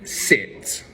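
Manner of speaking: short, firm command; authoritative. Shown as a demonstration. Owner telling the jumping dog to sit, authoritative. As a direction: say it with calm authority. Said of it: "in an authoritative voice".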